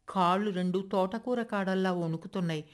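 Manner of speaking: plain reading voice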